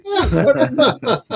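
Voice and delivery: high, loud words breaking into laughter; laughing. Real, not acted. Child reacting to dad's outburst in the car, laughing. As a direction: crack up mid-exclamation. Cracking up at retold story.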